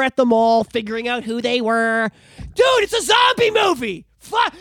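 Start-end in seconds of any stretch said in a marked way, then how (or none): 2.4-4.5 much higher, louder, slow, fed-up yell; exasperated shout. Real, not acted. Ranting to the co-host, an exasperated shout. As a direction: blow up over the wasted hour. Ranting about a boring episode.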